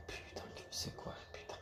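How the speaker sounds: whispering to himself in frustration; whispered curse. Real, not acted. Vlogger whispers frustration to himself, whispered. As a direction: hiss the curse under your breath.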